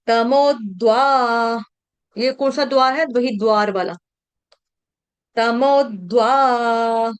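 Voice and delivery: तमोद्वा slow with द्व joined as one cluster; half d conjunct. Shown as a demonstration. Teacher drilling तमोद्वा syllable by syllable. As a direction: sound तमोद्वा slowly, half द gliding into व. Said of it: "इस तरह से तमोद्वा".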